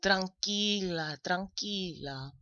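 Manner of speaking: slow, soft, hushing tranquila; soothing calm-down. Retold or imitated. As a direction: soothe the daughter slowly, tranquila. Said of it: "she's like".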